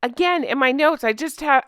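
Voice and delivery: normal talking voice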